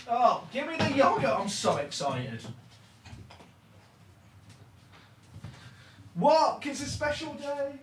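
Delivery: distant, away from the microphone; off mic. Real, not acted. Streamer walking off to the kitchen, far from mic. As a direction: call it from across the room.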